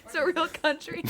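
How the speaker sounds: high-pitched, laughing echo. Real, not acted. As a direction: repeat it through real laughter. Laughing while echoing the friend's claim.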